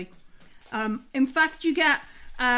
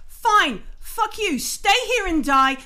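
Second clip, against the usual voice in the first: raised, bitter parting shot; angry dismissal; 5 semitones higher, 3 dB louder, slower than usual. Acted. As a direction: give up on them in anger. Miller storms off from Davis.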